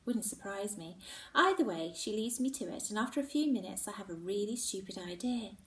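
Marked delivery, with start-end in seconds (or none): none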